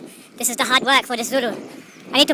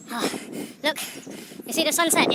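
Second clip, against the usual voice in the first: ordinary delivery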